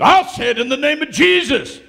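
high, loud command; commanding shout. Retold or imitated. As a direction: order the dead woman to rise. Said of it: "He said".

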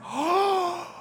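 high, excited discovery voice; amazed. Retold or imitated. Preacher recalling watching the Marvel movie, amazed. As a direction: gasp in wonder at the hidden connection. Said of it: "I was like, gasps".

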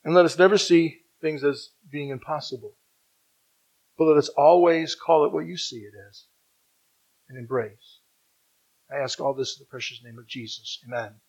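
low, hushed prayer voice. Real, not acted. Preacher closing a prayer in Jesus' name, low and soft. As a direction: pray softly, letting the phrases fall away.

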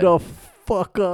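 put-on loud, slow New York accent. Finishing the New York accent bit, loud. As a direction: punch it like a New Yorker. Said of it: "the New York accent's just".

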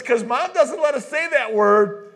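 a hint of a kid tattling on Mom; mildly imitating his daughter. Dad voices his daughter.

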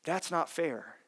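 hushed mock complaint; soft echoed protest. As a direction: let the protest fall to a murmur. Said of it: "our initial response is to say".

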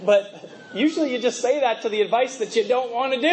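ordinary talk

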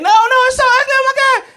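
very high, loud horrified shriek; panicked mom shriek. Retold or imitated. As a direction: scream like you just saw snakes. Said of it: "she's like".

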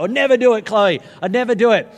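loud, high mock piety; proudly refuse, hammed up; hammed-up insistence; mock-righteous refusal. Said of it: "And I was like".

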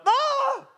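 very high comic scream; high-pitched cry of dismay; horrified yelp. Retold or imitated. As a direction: let out a high mock-horrified "ah". Retelling horror at autocorrected text.

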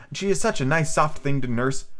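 sweet storybook heroine; voiced Alice. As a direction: read it fondly as little Alice. Alice voice, then reader's puzzled repeat.

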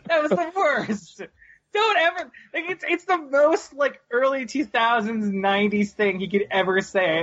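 high, slowed disbelief; incredulous rant. Real, not acted. Fan ranting about a cheesy line, high incredulous. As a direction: rant in exasperated amusement.

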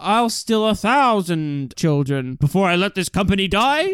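hammed-up spider boss, breaking into laughter; Mister Waternoose. Co-host corrects the spider boss quote, slow villain impression. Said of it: "what does he say in Monsters".

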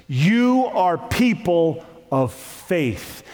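slow, weighted "You are people of faith" with stress on "You"; slow it right down, hit "You"; slow solemn emphasis. Acted. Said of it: "and I'm telling you".

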